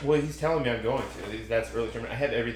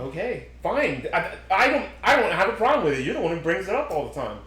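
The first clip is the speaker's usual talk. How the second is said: higher-pitched, exasperated comeback; defensive retort; 5 semitones higher than usual. Real, not acted. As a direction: argue back, pitch jumping up. Snapping back in an argument.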